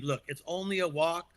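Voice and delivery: normal talking voice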